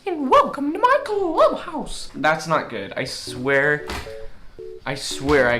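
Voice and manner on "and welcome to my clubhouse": much higher squeaky cartoon mouse greeting; Mickey Mouse. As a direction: squeak out a cheerful cartoon hello. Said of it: "I can do a Mickey Mouse impression".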